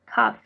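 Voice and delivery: "Cough" with the gh said as F; a lone model "Cough", F at the end. Shown as a demonstration. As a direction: demonstrate "Cough" ending on an F sound. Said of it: "como si al final tuviera una F".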